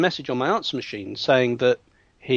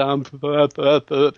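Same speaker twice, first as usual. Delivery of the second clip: stuttering on the burp sound; imitating a stammer; 4 dB louder, much slower than usual. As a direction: stammer over each burp, louder at the end. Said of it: "got to do something about this stammer".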